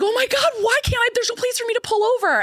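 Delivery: high mock panic; panicked self-quote. Mom on the highway with nowhere to pull over, panicking. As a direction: wail it high like you're freaking out. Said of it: "And I'm like".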